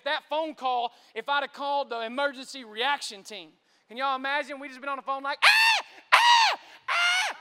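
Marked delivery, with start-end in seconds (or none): 5.4-7.4 very high, loud hysterical shrieks; mock screaming. Imagined emergency reaction team on the phone, hammed-up screaming. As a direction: scream repeatedly like a panicking team. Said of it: "everybody screaming".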